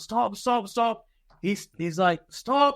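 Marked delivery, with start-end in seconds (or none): 0.0-1.1 high-pitched mock shouted order; imitated instructor shout. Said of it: "when they shout". 2.3-2.8 higher-pitched mock command to halt; imitated instructor shout. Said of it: "when they shout".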